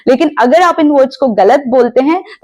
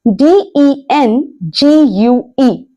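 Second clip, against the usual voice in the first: letter by letter, spaced and slow; slowly spelt letters; slow spelling; 2 semitones higher, much slower than usual. Shown as a demonstration. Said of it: "spelling the word".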